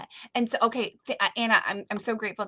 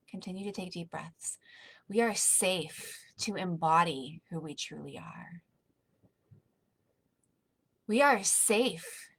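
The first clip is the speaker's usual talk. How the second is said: soft, calm breathing guidance that fades toward a whisper; guided meditation; 3 dB quieter than usual. Acted.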